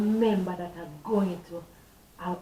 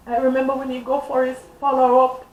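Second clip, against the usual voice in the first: ordinary talk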